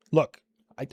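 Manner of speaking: ordinary talk, nothing marked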